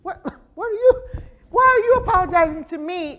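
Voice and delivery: high, loud baffled question; acted high-pitched incredulity; incredulous protest. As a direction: stammer in mock disbelief at an apology. Acted reaction to a crying apology.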